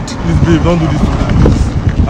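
loud, urgent begging; desperate pleading. Acted. Fiancé begs bride not to leave.

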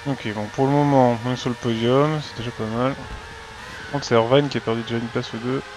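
usual speaking voice

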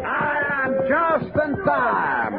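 drawn-out triumphant call. Acted. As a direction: hail the camp as you ride in. Arriving rider calling out.

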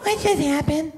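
put-on high little-girl voice, dazed and laughing. Little girl after the broom ride, high and dazed.

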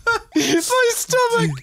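high, slow, wheezing through laughter; laughing so hard it hurts; helpless laughter. Real, not acted. Watching his mate fail, real helpless laughter.

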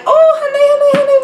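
high, loud squealing "Oh, honey, honey, honey"; excited squeal. Retold or imitated. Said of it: "I'm like".

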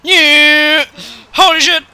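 high, loud exhilarated shout; triumphant whoop. Real, not acted. Gamer whooping loud and high at a spectacular crash.

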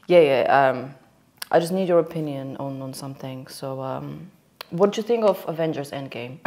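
low-pitched deadpan build-up to a joke; mock-serious. Asking worried boss about Avengers Endgame, mock-serious. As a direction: keep it straight-faced and low. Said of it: "I'm keeping on, like, a serious face".

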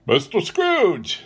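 high, slow, announced toast; Bob proposing. A narrator's reading. Bob proposes his employer's health to the family, ceremonious. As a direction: draw the name out as a toast. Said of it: "said Bob".

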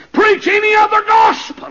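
high, loud burst of scripture; sudden pulpit roar; preaching shout. Real, not acted. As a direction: thunder the warning at full force.

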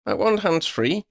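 high-pitched call over the shoulder; high, mildly shouted reply. A narrator's reading. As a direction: call back to your friend, pitched high. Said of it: "Lydia shouted over her shoulder".

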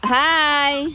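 loud high hello across the ice; calling out. Real, not acted. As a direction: call out to someone far away. Greeting people far off.

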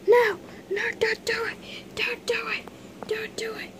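high pleading squeal; childlike mock fear; pretend pleading. Victim pleads for mercy.

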